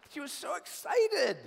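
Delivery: said through a high, loud laugh. Real, not acted. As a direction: laugh while telling it. Pastor laughing recalling Lori.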